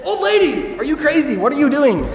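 put-on protesting to the old healer; mock alarmed protest. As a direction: yelp it in disbelief.

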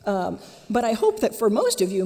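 ordinary delivery

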